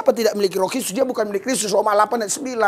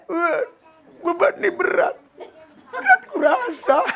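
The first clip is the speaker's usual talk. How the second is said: mock whiny prayer; 8 semitones higher than usual. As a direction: whine it out like a self-pitying believer. (doa cengeng yang dibuat-buat, tinggi dan lambat)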